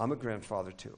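soft, low reassurance. Retold or imitated. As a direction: gently show fellow feeling. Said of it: "lowered my voice real low". (leise und mitfühlend)

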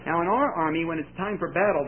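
usual speaking voice

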